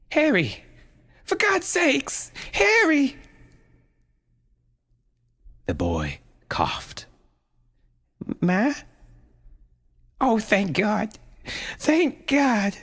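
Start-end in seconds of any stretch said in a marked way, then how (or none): 0.1-3.2 desperate call. A narrator's reading. Mother screaming for her unresponsive son, desperate. 8.4-12.8 relieved voice. A narrator's reading. Relieved voice after waking.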